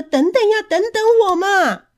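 put-on high, loud child calling after the others; anxious shout. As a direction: yell desperately not to be left behind. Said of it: "急着大声地叫着".

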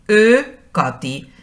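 slow, careful Hungarian "Ő Katy" with a long rounded Ő; pronunciación lenta del húngaro "Ő Katy". Shown as a demonstration. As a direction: say "Ő Katy" slowly like a Hungarian teacher. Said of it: "Si yo quiero decir".

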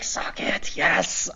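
breathless whispered cheer; whispered excitement. Retold or imitated. Fan at home whispering his triumph. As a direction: whisper it like a secret victory. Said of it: "And I was like".

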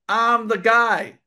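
slow, loud "I'm the guy"; proud, drawn-out claim; emphatic boast. Real, not acted. Boasting his correct prediction.

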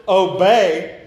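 "obey" said as a command; stress on obey. Shown as a demonstration. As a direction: punch the word "obey". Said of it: "not a suggestion".

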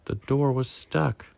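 usual speaking voice

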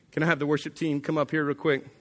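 ordinary delivery

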